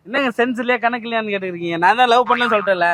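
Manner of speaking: ordinary talk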